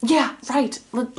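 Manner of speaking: ordinary talk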